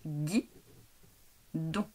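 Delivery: guidon split in two detached syllables, gui then don; robot-style syllable split of guidon; two separate robotic beats. Shown as a demonstration. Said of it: "je vais dire".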